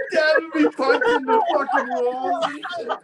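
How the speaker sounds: laughing while talking; words breaking into laughter. Real, not acted. Laughing description.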